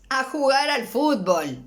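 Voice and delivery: put-on child telling mom he is off to play football, eager. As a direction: announce the plan proudly. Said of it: "le dice el niño".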